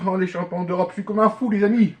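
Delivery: usual speaking voice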